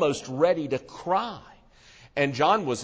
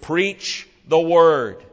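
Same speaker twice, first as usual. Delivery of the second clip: loud, slow, every word hammered; thundering command; 3 semitones higher, 8 dB louder, much slower than usual. Acted. Preacher booming Paul's command to Timothy, loud and slow. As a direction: proclaim it like a herald from the pulpit.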